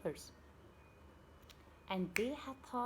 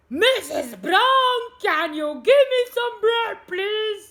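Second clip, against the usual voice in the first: slow, very loud, higher yell; hammed-up frustrated scream; 11 semitones higher, 21 dB louder, slower than usual. A narrator's reading. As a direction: scream it for the whole street. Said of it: "He screamed louder".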